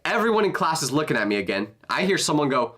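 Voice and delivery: usual speaking voice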